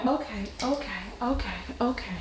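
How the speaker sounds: calming a fussy newborn; soothing. Real, not acted. Mother soothing a crying baby.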